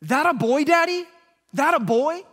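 high, loud imitation of a toddler; way too loud. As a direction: blurt it out like an oblivious little kid. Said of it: "he says in way too loud of a voice".

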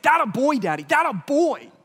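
loud, high toddler imitation; insistent child's shout, acted out. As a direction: mimic a little kid insisting at full volume. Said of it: "so he just gets louder".

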